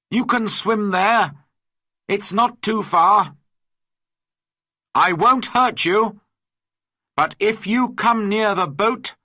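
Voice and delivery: high, loud calling across water; shouting to him. A narrator's reading. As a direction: shout a warning to a distant swimmer. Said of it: "I shouted to him".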